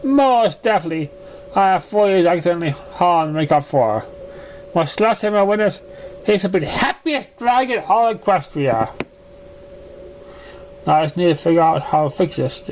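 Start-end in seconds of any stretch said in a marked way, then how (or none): 0.0-8.9 a refined mare, gently defensive; Rarity-like lady. Acted. Narrator voices the same lady.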